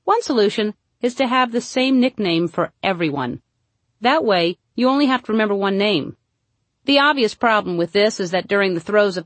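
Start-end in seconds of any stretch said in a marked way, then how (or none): none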